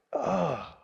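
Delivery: soft, breathy sigh of unease; uneasy sigh. Acted. Preacher reacting to Jonah's selfish prayer, uneasy sigh. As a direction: let out a hushed, troubled oh.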